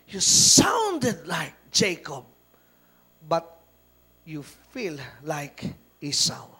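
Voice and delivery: put-on slow, low old father's voice; slow, low old Isaac. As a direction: drag the words out like old blind Isaac. Isaac speaks to his son. (mabagal at mababang boses ni Isaac)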